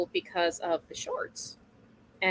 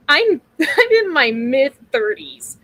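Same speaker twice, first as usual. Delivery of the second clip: usual speaking voice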